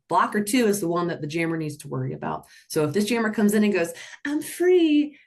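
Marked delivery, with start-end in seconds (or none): 4.2-5.2 gleeful. Pretend. Jammer voiced cutting gleefully.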